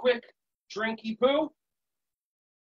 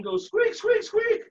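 very high squeaky mouse voice; mouse squeak; 14 semitones higher, 3 dB louder, slower than usual. Shown as a demonstration. Teacher showing kids mouse squeaks, high squeak. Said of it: "try to make a mouse noise".

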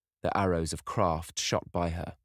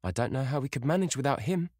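a hint of a fretful older woman; lightly voiced complaining mother; mildly querulous mother; 8 semitones higher, faster than usual. A narrator's reading.

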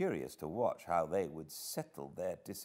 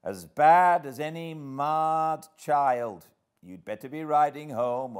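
higher, forceful called-out advice; calling out; 8 semitones higher, 8 dB louder than usual. A narrator's reading. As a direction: project it across a courtyard. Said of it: "I called out".

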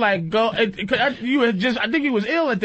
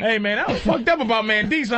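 normal talking voice

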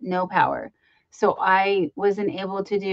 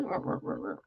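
whispered little ruh ruh squeaks; guinea pig noises; 14 semitones lower, 14 dB quieter, faster than usual. Retold or imitated. Host imitating her pet's guinea pig sounds, whispered. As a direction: mimic a tiny guinea pig, breathless and soft. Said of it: "she goes".

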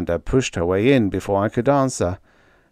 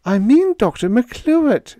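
much higher, louder squall; 6 dB louder than usual. A narrator's reading. Panda squalling at Freddie about the doctor. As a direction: squall it out, upset. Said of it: "she squalled".

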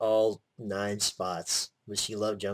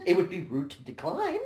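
higher teasing echo of her; laughing echo; 7 semitones higher than usual. Retold or imitated. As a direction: repeat her line mockingly. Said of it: "She said".

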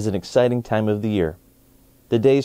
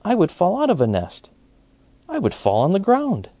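higher little bunny voice; 8 semitones higher than usual. A narrator's reading. Bunny refuses the robin's nest, higher voice. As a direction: speak it as a small worried bunny. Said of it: "said the bunny".

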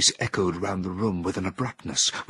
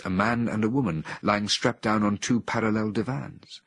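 ordinary delivery, nothing marked

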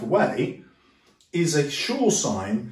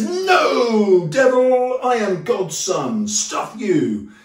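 high, loud defiant cry at the devil; hammed-up shout of refusal; mock heroic shout; 7 semitones higher, 5 dB louder, much slower than usual. Jesus shouting down the devil, mock defiant.

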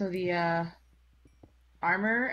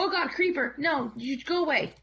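higher, louder alarmed cry; startled; 8 semitones higher, 4 dB louder than usual. Real, not acted. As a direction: jump at the sudden creeper. Creeper suddenly appears.

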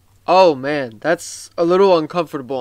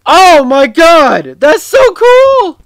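much higher and louder, slow, stretched-out squeal of joy; thrilled outburst; 11 semitones higher, 11 dB louder, slower than usual. Real, not acted. Gamer discovering nostalgic Roblox items, high slow thrilled shout.

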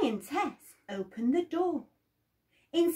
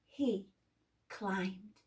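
ordinary delivery, nothing marked

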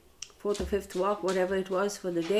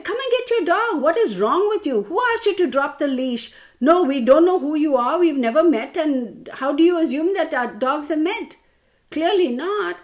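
high, loud angry tirade, retold; really losing it; 6 semitones higher, 6 dB louder than usual. Dog owner scolding lady who dropped the leash, retold fury. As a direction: re-enact telling someone off at full tilt. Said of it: "I really lost it".

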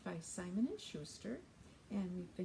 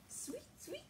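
very high, soft birdsong; bird chirp; 7 dB quieter than usual. Acted. Storyteller voices Bird for the children, high chirp. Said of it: "said Bird".

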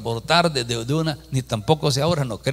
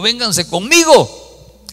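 loud, slow, higher call; 9 semitones higher, 9 dB louder, much slower than usual. Retold or imitated. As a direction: call the group over, drawn out. Calling old ladies in airport. (llamada fuerte y lenta para reunir al grupo)

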